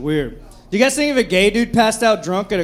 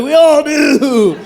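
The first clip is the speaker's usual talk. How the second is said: cheering crowd; 7 semitones higher, 7 dB louder, much slower than usual. Retold or imitated. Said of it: "Everyone's like".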